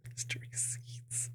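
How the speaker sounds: breathy, sly whisper, drawn out; sly nasal snickering whisper. Pretend. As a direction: whisper it like a sneaky secret. Whispered repeat of hint.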